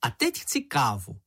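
high, squeaky scoffing voice; high-pitched little creature, amused. A narrator's reading. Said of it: "smál se čenich".

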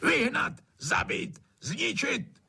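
very high, frantic hollered orders; high-pitched ranting; hollering. A narrator's reading. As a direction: holler each command as a separate burst. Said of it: "a hulákal".

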